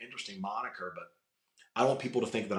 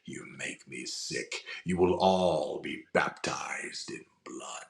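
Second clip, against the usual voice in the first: menacing whisper; 6 semitones lower, slower than usual. Acted.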